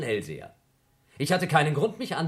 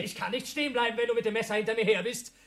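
high, loud, agitated; frantic; 10 semitones higher, 4 dB louder than usual. Acted. To Mills during the knife standoff, frantic and high. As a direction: dodge and shout back in panic.

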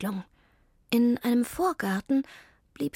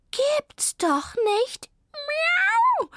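high, loud, drawn-out yowl; meowing cat; 5 dB louder, much slower than usual. A narrator's reading. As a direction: let disbelief rise into a loud meow. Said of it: "miaute Zelda". (laut und hoch miauend)

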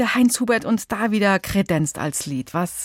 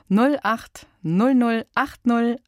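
slow dictation; much slower than usual. Shown as a demonstration. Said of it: "Ich sag die Nummer".

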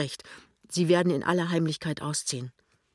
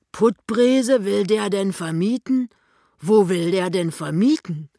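loud, higher incredulous questioning; booming astonishment, pitched up; blustering shopkeeper husband; 4 semitones higher, 8 dB louder than usual. Acted. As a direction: exclaim in loud disbelief. Voices the fat husband.

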